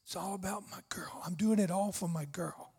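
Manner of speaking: put-on whispered tenderly as God to his girl. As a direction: breathe it, don't voice it. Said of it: "He says".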